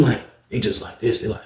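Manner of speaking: ordinary delivery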